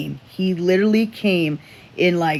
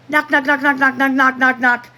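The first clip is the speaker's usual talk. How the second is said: imitated knocking; 10 semitones higher, 6 dB louder than usual. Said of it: "I hear".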